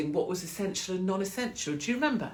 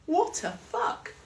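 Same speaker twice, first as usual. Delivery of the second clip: high, slow disbelieving cry; incredulous; 11 semitones higher, much slower than usual. Real, not acted. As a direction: stretch it out in disbelief. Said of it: "outraged at lockdown rule".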